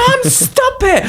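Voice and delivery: put-on very high, slow child pleading; whiny kid plea. Child in the car begging mum to stop, very high whine. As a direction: whine like an embarrassed little kid. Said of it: "I'd be like".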